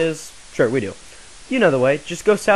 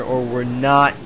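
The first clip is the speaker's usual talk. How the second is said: normal talking voice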